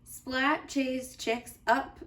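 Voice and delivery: usual speaking voice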